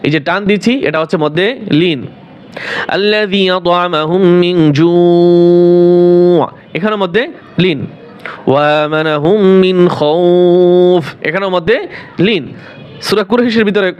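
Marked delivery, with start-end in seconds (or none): 2.9-6.5 slow, chanted tajweed recitation; Quran recitation. Acted. Teacher reciting Surah Quraish as an example. 8.5-11.0 slow, chanted tajweed recitation; Quran recitation. Acted. Teacher reciting Surah Quraish as an example.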